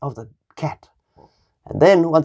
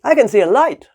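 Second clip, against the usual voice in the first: rooster voice; 6 semitones higher, 4 dB louder than usual. A narrator's reading. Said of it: "he said".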